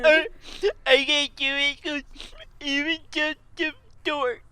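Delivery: high, squeaky stammering dork voice; self-doubting dork. Acted. Adam believing he is just a dork, squeaky self-doubt. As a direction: squeak out a hopeless protest. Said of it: "is what Adam believes".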